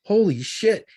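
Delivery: a loud, high burst of disbelief; shocked exclamation. Retold or imitated. Dad reacts to daughter confirming the drugs, stunned. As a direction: blurt it out, stunned.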